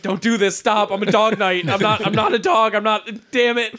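a proud knight insulted, half-joking; hammed-up outrage, pushing him away; indignant dog protest. Dog protesting the belly rub.

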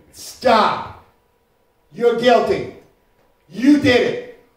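loud, firm verdict; stern parent shout. Pretend. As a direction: shut down the guilty kid. Parent stops arguing kid.

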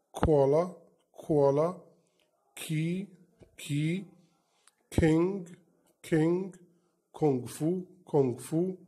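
each K word said twice, clear and lifted; teacher drill. Shown as a demonstration. Teacher drilling K words for kids, clear and lifted. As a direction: model words for children to repeat. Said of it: "هننطق الكلمات مع بعض".

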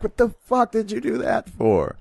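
high, indignant cartoon townsperson; shocked and outraged cartoon crowd; outraged townsfolk. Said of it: "the whole town was like".

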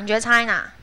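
normal talking voice

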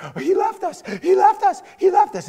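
high, loud frightened wail; panicked kid. Retold or imitated. Kid alone in the car with the dinosaur, high frightened cry. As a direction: whimper it like a terrified child. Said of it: "and he's like".

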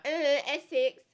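loud, high Essex caricature; hammed-up Essex greeting, pitched high; mock Essex accent. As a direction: holler it in broad Essex. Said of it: "You should be like".

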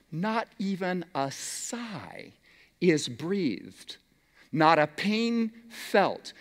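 slow, soft quotation with long pauses; hushed solemn reading. A narrator's reading. Preacher reading a devotional line to congregation, hushed and solemn.

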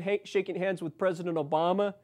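ordinary talk

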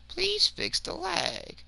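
high, slow whiny plea; mock pleading. Gamer begging the server to fix lag, high and whiny. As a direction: beg the lag away like a squeaky child.

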